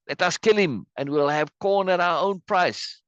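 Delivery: high-pitched theatrical rabble-rousing; acted call to arms, pitched high; inciting citizen. Reads citizen inciting the mob.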